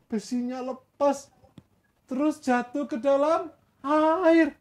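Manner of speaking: high voice, broken into halting phrases; sheepish student role. Acted. Student admits to Elisha where it fell, high halting voice. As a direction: confess it piece by piece, high-pitched. Student confesses the lost axe head.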